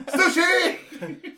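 high, laughing cry of triumph; excited shout. Pretend. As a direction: announce it proudly, laughing. Mock eater triumphant.

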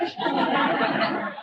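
a quick airy chuckle at the joke; short breathy laugh. Real, not acted. Preacher laughing with the congregation at his own joke. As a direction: laugh lightly, mostly breath.

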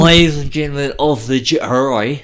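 badly mangled Southern courtroom accent; Southern lawyer accent. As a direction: address the jury in a wobbly Southern accent. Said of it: "Southern lawyerish".

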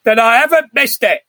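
a hint of a delighted old miser; Alistair Sim impression. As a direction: lean into a hammy old-film Scrooge. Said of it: "Alistair Sim impression".

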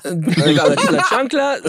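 high laughing words; chuckling. Real, not acted. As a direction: laugh out the punchline. Laughing recalling the sandal.

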